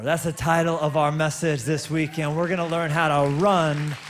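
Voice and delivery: loud, rising sermon announcement; preaching at full force. Acted. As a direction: proclaim it to the whole hall. Preacher raises voice announcing title.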